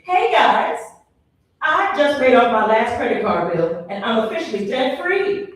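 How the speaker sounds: high, loud chirpy brag; bubbly mock cheerleader voice; perky debt-free woman. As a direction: chirp it like a proud member. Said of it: "she goes".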